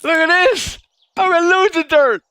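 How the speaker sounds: high, loud gleeful crowing; excited. Real, not acted. Gamer crowing to viewers, high and loud with glee. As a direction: squeal it out with delight. Excited over game dirt.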